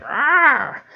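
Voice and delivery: a little creature jumps in fright; startled kobold yelp. Acted.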